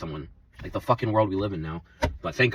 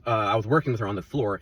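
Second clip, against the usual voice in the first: normal talking voice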